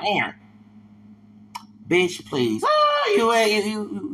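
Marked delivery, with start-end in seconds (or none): 2.6-4.1 shocked squeal. Real, not acted. Squeal of disbelief.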